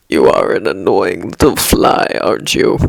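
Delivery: very low, menacing villain voice; deep voice, toying with a pest; sinister low taunt, falling away at the end; villain's taunt. A narrator's reading. Villain Kurogiri taunts the boy.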